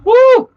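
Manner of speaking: loud, high-pitched "woo" cheer; excited whoop. Real, not acted. Streamer after toasting the viewers, whooping loudly. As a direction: let out a sudden high whoop.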